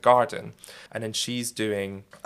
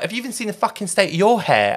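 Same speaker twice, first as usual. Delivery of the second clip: louder, higher, aggressive yell; mock angry shouting; 11 semitones higher, 9 dB louder than usual. Kate Moss yelling at a stranger at a party, angry. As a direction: bark it like a furious celebrity. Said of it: "shouting all this abuse".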